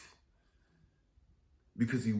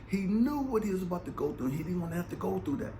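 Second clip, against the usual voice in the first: 1 impassioned preaching; 9 semitones higher, 5 dB louder, faster than usual. Real, not acted. Building intensity in sermon.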